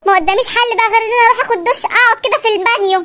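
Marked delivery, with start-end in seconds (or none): none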